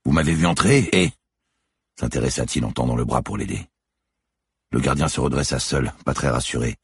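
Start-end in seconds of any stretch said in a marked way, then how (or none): 0.0-1.1 more conciliatory. A narrator's reading. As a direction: invite the frightened man to continue. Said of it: "prit un ton plus conciliant". (ton plus conciliant, qui invite à poursuivre)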